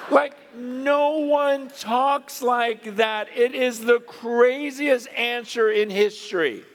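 high, slow, emphatic exclamation; emphatic amazement. Real, not acted. Preacher marvelling at Jesus's answer to Philip, emphatic amazement.

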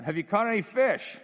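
loud high holler across the water; yelling out. Acted. Someone from the shore to the fishermen, yelling out. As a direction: call out to people far off. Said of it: "yells out".

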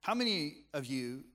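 ordinary talk, nothing marked

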